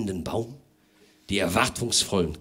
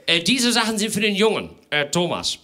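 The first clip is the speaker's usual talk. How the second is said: high, hurried, hemming gentleman; a busy host rushing things along; fussy host; 10 semitones higher, 5 dB louder than usual. Acted. Herr Lemm voiced.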